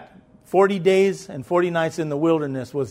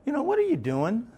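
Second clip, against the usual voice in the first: self-scolding quote; 8 semitones higher, 4 dB quieter than usual. Retold or imitated. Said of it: "and I'll be like".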